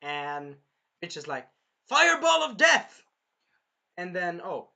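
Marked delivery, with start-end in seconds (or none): 1.9-3.0 high, very loud battle cry; attack shout. Retold or imitated. Villain launching her final attack, shouting it. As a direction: shout out a spell name at full force.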